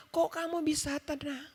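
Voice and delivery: light mock astonishment; imitating sister-in-law. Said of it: "kakak ipar saya sampai bilang".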